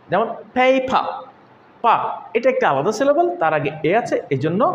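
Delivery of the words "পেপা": পেপা as "paay-pa", first vowel long; lengthened A. Shown as a demonstration. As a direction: stretch the "ay" in পেপা. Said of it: "উচ্চারণ করছি একটু লম্বা করে". (পেপা-র প্রথম এ টেনে লম্বা করে)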